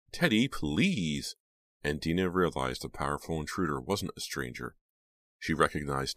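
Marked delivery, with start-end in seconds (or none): 0.1-1.3 slow, high begging plea; desperate plea. A narrator's reading. Jade pleading with Teddy, desperate plea. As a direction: plead with him, slowly. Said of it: "Jade's desperate cries".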